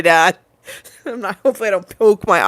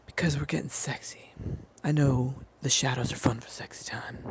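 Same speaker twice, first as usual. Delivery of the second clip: low, husky sexy whisper; 5 semitones lower, 9 dB quieter, faster than usual. Pretend. Streamer whispering her sexy song version to chat, low seductive whisper. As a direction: breathe it like a seduction. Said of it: "I can do it the sexy version".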